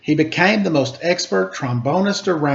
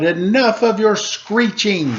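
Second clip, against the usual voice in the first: raised, higher angry outburst; a child fed up, yelling at the music; shouted; 6 semitones higher than usual. Acted. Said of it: "and he shouted".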